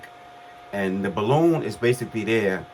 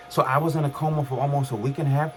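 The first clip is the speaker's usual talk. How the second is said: normal talking voice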